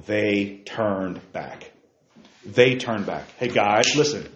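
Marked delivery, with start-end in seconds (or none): 0.1-1.7 slow emphasis. Shown as a demonstration. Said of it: "What does the next three words say?".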